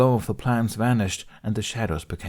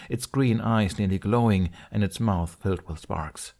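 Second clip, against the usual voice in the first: ordinary delivery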